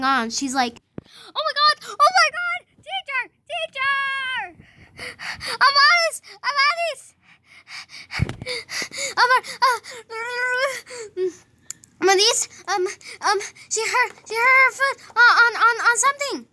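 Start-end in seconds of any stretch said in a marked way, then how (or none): 1.1-7.1 high-pitched frantic cry for the teacher; hammed-up shriek, drawing out the call; panicked child. Classmate calls the teacher about an injury, panicked. 9.2-16.5 high, loud, frantic gabble; talking too fast. Acted. Classmate tells the teacher about the hurt foot, gabbling. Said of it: "why are you talking so fast?".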